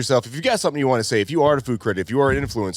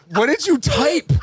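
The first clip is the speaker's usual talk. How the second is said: hiccup giggles; 10 semitones higher than usual. Real, not acted. Laughing at friend's typo.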